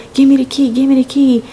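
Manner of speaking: high-pitched insistent demand; mock frantic demand. Girlfriend kicking her for the key, mock frantic. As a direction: press for it on a high, urgent note.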